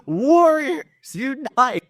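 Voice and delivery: high, slow rallying shout; rally the rebel librarians, hammed up; mock battle cry.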